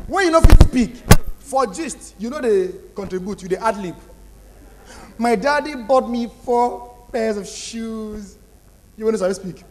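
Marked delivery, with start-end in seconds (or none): 5.2-9.6 put-on slow, showy spoiled-girl voice; mock rich-girl boast; bragging girl. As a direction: drag it out like a spoiled brat.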